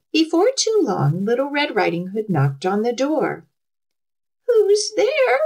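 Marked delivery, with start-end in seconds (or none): 4.5-5.4 high put-on old lady voice; wolf pretending to be a granny; wolf as Grandma. A narrator's reading. Wolf in bed answering the knock, faking Grandma's voice. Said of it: "in a voice like Grandma's".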